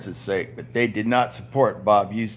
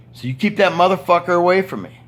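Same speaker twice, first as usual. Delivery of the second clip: raised and higher, hammering the demand home; louder heated demand; 8 semitones higher, 5 dB louder than usual. Retold or imitated. Worker's last demand to supervisors about the boss, loud and heated. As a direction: push it up and out, fed up.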